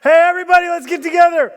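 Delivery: high, loud rallying call; calling out to the crowd. Retold or imitated. King rallying the nation, calling out loud. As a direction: summon everyone loudly. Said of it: "He says".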